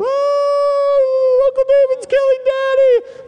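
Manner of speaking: put-on very high, loud child's shriek; little girls shrieking. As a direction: squeal like a panicked toddler. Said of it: "his two daughters".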